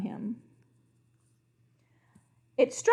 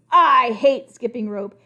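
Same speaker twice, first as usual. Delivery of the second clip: loud, slow furious outburst; lost temper; 3 semitones higher, 8 dB louder, slower than usual. Acted. Crocodile loses his temper at the king, loud and slow. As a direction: blow up and refuse. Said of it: "He lost his temper".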